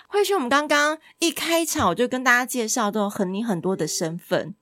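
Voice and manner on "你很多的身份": ordinary talk, nothing marked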